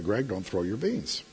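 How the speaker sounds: put-on soft, patient correction to a toddler; a patient dad's soft warning; calm first warning. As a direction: gently tell the baby no, quietly. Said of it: "Finally, I yelled at him".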